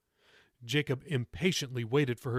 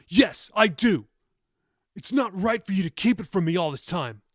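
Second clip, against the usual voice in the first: angry insistence; 5 semitones higher, 6 dB louder than usual. A narrator's reading. Grandson insisting grandmother tell the truth, angry and loud. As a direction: insist hard, voice raised.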